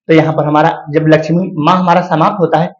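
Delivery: normal talking voice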